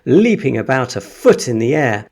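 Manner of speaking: ordinary delivery, nothing marked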